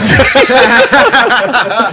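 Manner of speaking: high, hearty cackle; big laughing outburst; loud laughter. Real, not acted. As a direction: burst out laughing at yourself. Laughing at own admission.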